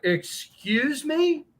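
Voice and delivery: slow, high-pitched indignant retort; retold indignation. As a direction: act out stunned offense, slowly. Retold shock at stranger's remark.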